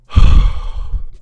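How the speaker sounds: loud, heavy exhale; forceful voiceless sigh; exasperated sigh. Real, not acted. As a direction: blow out all the frustration. Venting after losing shot.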